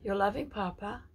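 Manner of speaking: tender prophetic declaration. Acted. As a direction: speak as God comforting his child. Signing as God.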